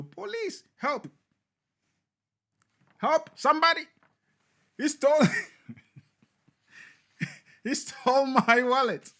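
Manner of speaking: high, loud hammed-up cry for help, a laugh breaking in; mock screaming for help. Robbed man screaming to the police and passers-by, high, loud and hammed-up. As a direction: yell for the police like the wallet's just gone, half laughing. Victim screams after wallet stolen.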